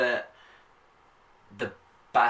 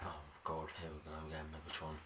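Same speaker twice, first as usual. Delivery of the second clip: much softer exasperated aside; fed-up groan; 4 semitones lower, 15 dB quieter, faster than usual. Retold or imitated. Reviewer tired of Megatron's return, soft weary aside. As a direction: sigh it out, sick of him. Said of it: "I just like".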